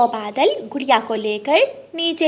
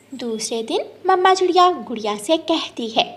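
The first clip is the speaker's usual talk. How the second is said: plain reading voice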